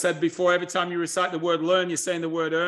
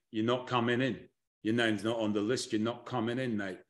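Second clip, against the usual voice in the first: low, soft Southern accent bouncer turning someone away; Southern accent, dropped low and quiet like a doorman at the rope; 8 semitones lower, 8 dB quieter than usual. Shown as a demonstration. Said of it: "a Southern accent when I do that one".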